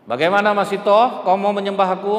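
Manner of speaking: raging king commanding Haman; furious Pharaoh. Acted. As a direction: bellow the orders to boil them. Pharaoh demanding worship.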